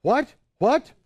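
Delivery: confused. Retold or imitated. Confused reply to yelling guards.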